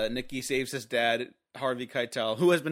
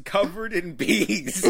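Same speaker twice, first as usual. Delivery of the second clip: laughing quote; 5 semitones higher, 4 dB louder, slower than usual. Retold or imitated. Quoting line while laughing.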